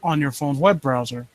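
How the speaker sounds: low, hushed creepy-story reading; story narration. Acted. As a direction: draw the listener into the dread. Reads creepy story aloud.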